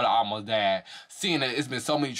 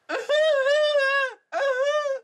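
high, loud fake wail; mock wailing cry; 5 dB louder than usual. Mocking Sarah crying on camera, high wailing sob.

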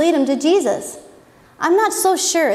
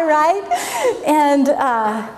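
ordinary talk

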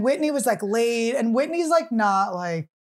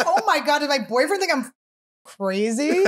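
panicked Whitney; 5 semitones higher than usual. Retold or imitated. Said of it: "Whitney's like".

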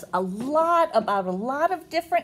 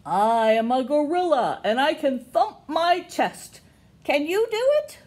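loud, lively read-aloud with a high question; big storybook gorilla line; reading to children; 4 semitones higher, 5 dB louder than usual. Acted. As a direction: read boldly to little ones, lift the question. Reading picture book to kids.

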